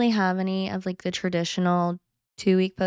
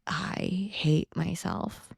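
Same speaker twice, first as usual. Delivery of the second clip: slow, much softer confession; subdued self-loathing; 9 dB quieter, much slower than usual. Retold or imitated. Confessing inner feeling.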